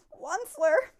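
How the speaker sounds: loud, high croaked call; croaking cry. A narrator's reading. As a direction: Lorax crying out to the Onceler, loud croak. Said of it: "he cried with a crofulous croak".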